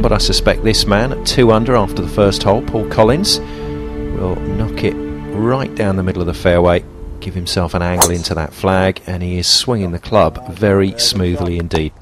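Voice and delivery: hushed golf commentary; golf commentator. Acted.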